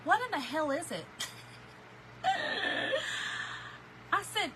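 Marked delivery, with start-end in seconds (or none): 0.0-1.1 mildly raised, puzzled husband; a hint of his outrage; his exact shocked question; quoting husband. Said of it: "this is his exact words". 1.1-4.1 high, breathless wheezing laugh; can't catch breath from laughing; real helpless laugh. Real, not acted. Laughing at husband's reaction.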